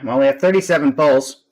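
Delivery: usual speaking voice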